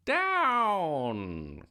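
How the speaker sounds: high witch's cry, long and falling; storybook witch calling a command. A narrator's reading. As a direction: draw the word out in a high falling call. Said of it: "cried the witch".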